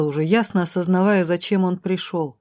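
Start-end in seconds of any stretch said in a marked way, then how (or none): none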